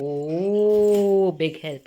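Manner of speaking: long drawn-out, loud "oh"; playfully exaggerated praise; mock-impressed. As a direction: gush over a child's little help. Mock-impressed praise of child's help.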